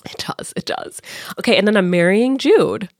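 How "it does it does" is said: whispered agreement. Real, not acted. Agreeing it feels wrong.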